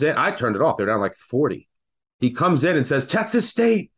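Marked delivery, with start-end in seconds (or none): 3.1-3.9 higher mock yell of Texas State; half-acted excited yell, pitched up high; retold scream.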